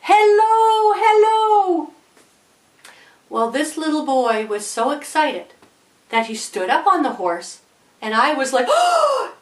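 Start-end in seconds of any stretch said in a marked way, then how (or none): 0.0-1.9 high, stretched-out crowd holler; kids screaming hello. Acted. Audience kids screaming back to the boy, high holler. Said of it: "All the kids were screaming". 8.7-9.3 very high gasped oh; sharp, high intake of alarm; shocked gasp. Acted. Watching boy stand on horse, high alarmed gasp. Acted shocked reaction to danger.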